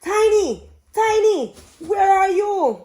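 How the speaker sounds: high, loud calling for a lost cat; a princess shouting for her cat, high and loud. A narrator's reading. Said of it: "the princess called her cat".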